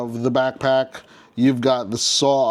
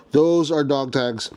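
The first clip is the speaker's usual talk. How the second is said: ordinary talk, nothing marked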